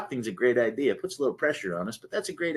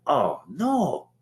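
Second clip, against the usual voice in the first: much higher and louder, slow, like a horrified realization; mock dismay; 13 semitones higher, 6 dB louder, slower than usual. Recalling standing up at his first AA meeting, mock horrified. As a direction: stretch it out in startled dismay. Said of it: "And I went".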